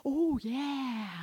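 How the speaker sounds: soft whispered oh yeah; whispered realization. Retold or imitated. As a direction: breathe it out as it dawns on you. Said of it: "I was like".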